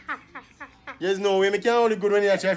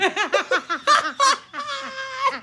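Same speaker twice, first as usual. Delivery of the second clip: high laugh. Real, not acted. Laughing at his own story.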